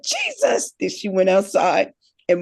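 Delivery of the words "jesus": very high, breathy exclaim; stunned at what happened; shocked mom. Retold or imitated. Mother hearing the boy got kicked, shocked gasp.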